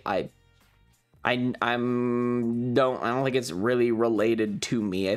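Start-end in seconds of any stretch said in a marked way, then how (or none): none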